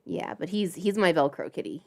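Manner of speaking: usual speaking voice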